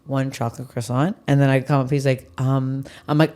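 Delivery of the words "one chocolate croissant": soft, low, dry order call; deadpan barista. Retold or imitated. Imitating the barista quietly calling the order, soft and dry. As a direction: say it flat and knowing under your breath. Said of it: "he goes".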